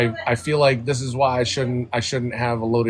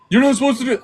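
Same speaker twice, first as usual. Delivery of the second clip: higher, louder angry yell; retold yell; 11 semitones higher, 7 dB louder, faster than usual. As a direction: shout it out the car window. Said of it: "screaming that".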